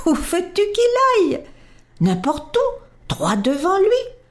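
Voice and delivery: put-on a boy amused by the idea; little prince delighted. A narrator's reading.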